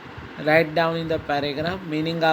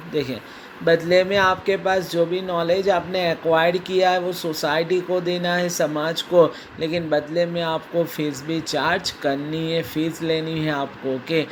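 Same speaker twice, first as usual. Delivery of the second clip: normal talking voice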